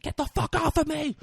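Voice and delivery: high-pitched yelled scream. Retold or imitated. Beth fights off Statham's character, high scream. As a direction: shriek it up high in protest.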